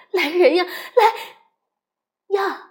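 high, loud call for help; alarm shout. Acted. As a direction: cry out for help while running. Mother calling for help.

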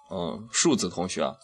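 usual speaking voice

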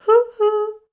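very high, loud hooting; owl hoot. A narrator's reading. Owl hails the spider with high hoots. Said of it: "asked the owl".

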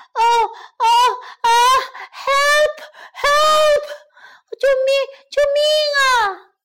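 high, loud drowning wolf; hammed-up panicked screams; terrified shouting. A narrator's reading. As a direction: cry desperately for help. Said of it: "大灰狼吓坏了，拼命挣扎着喊".

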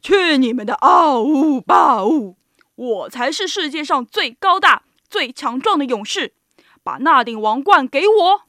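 loud, booming boast; full-voiced, bullying giant's demand; giant shouting. A narrator's reading. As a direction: bellow down at the little animals. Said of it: "巨人凌空对他们三个喊道".

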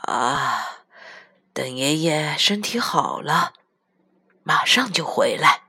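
put-on low, slow old man's voice; aged grandfather comforting a child; reassuring grandpa. A narrator's reading. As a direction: promise it slowly and tenderly. Grandpa promises child to come back.

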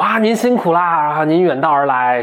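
拖长调的恭敬迎客腔，音调偏高; 像小兵点头哈腰地迎接贵客; 恭敬的虾兵. Acted. 虾兵先生恭迎客人. (恭恭敬敬、拖长声调的虾兵腔)

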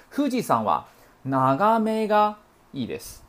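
deliberate teaching read; teacher's model sentence. Shown as a demonstration. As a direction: model the correct sentence clearly, slowing on the particle. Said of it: "这是日本人的习惯的说话方式".

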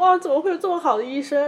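tearful wonder. Retold or imitated. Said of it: "我就说".

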